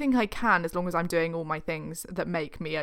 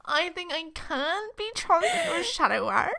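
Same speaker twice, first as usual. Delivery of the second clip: high, slow, overly earnest; mock sincere; 9 semitones higher, slower than usual. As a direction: play it solemnly sincere. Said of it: "The sincerity of us".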